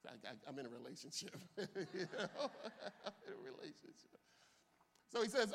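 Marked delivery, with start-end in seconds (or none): 1.6-4.2 laughing. Real, not acted. Preacher answers imagined critics, laughing through the words. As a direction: repeat it while giggling helplessly.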